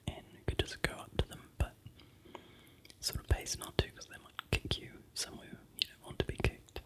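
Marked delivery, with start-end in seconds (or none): none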